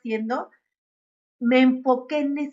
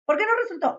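"¿Por qué no resultó?" asked much higher, puzzled; the same question pitched up in real wonder; questioning herself; 10 semitones higher, much faster than usual. Shown as a demonstration. Teaching herself to ask why it failed, higher puzzled question. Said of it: "a decir".

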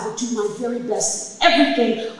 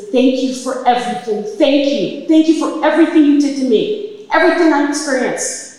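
fervent thanks; 5 semitones higher, 8 dB louder than usual. Retold or imitated. Kneeling, thanking the universe.

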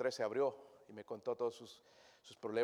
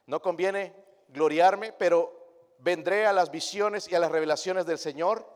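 slow, loud solemn reading; scripture reading; 4 semitones higher, 9 dB louder, slower than usual. Acted. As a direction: read the verse with weight. (lectura bíblica lenta y solemne)

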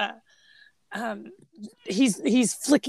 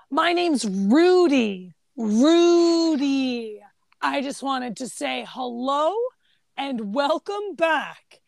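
slow, louder, drawn-out Rudy; slow earnest intro; 4 dB louder, much slower than usual. Retold or imitated. Young coworker greeting returning boss, slow and earnest. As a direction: stretch every word sincerely. Imitating young office worker.

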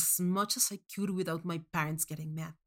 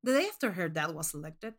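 ordinary delivery, nothing marked

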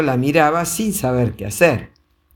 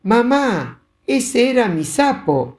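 put-on complaining boy; 9 semitones higher than usual. A narrator's reading. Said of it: "se quejó Pancho".

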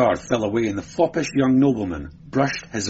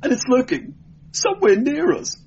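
frightened high voice; 3 dB louder, faster than usual. Acted. Frightened man whispering of lurking danger.

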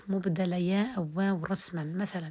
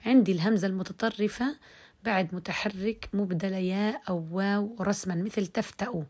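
normal talking voice

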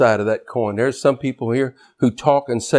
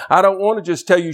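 building to a shout; 7 semitones higher, faster than usual. Real, not acted. Rising to a shout.

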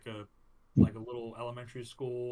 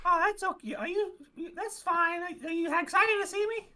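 very high cartoon mouse voice; Mickey Mouse; 3 dB louder than usual. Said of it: "Mickey, how you doing?".

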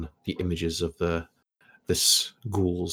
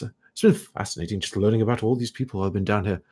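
ordinary talk

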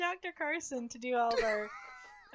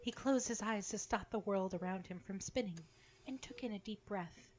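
story narration; 5 semitones lower, 11 dB quieter than usual. A narrator's reading. Narration between character lines.

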